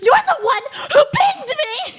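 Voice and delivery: high, loud, whiny laughing complaint; hammed-up outraged gamer; imitating an indignant jungler through laughter; mock upset teammate. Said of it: "he got so upset".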